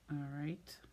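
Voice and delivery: whispered all right. Real, not acted. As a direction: breathe it under your breath. Quiet aside while pressing card.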